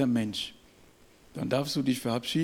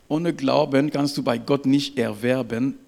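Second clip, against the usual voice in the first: ordinary delivery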